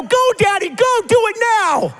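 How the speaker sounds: put-on high, excited kid voice egging Daddy on; kids cheering. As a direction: cheer like a thrilled little kid. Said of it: "And my kids would go".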